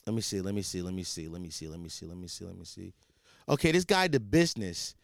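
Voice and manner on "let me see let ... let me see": muttered under the breath, fading away; fading mumble. Real, not acted. Scrolling through messages, muttering and fading away. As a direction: mumble it to yourself as it trails off.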